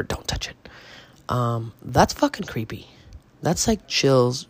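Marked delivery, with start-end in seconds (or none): 0.0-0.6 soft, breathy whisper. Retold or imitated. Joking with friends about the ghost, whispered. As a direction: whisper it creepily, as a joke. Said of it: "we'll just randomly whisper".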